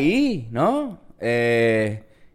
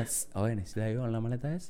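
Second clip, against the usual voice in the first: hushed hustler offer; 6 semitones lower, 17 dB quieter, faster than usual. Retold or imitated.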